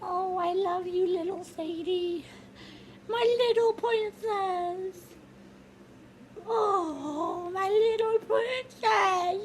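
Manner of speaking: put-on high, slow adoring baby talk; doting mom voice. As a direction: coo over the newborn. Mother adores the baby.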